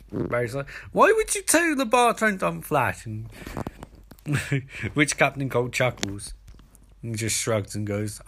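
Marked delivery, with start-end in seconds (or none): 0.9-3.0 high-pitched mock protest; indignant outburst. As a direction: voice shoots up, indignantly imitating Barry. Barry outed by Cold in bar.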